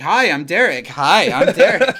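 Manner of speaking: eager, booming hello repeated; chipper Derek. Retold or imitated. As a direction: introduce yourself to a plant with glee. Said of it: "He's like".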